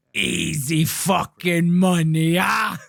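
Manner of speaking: slow, shouted mobster bark; gangster impression. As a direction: drag it out and shout like the thug. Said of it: "Oh, I wish you could hear him".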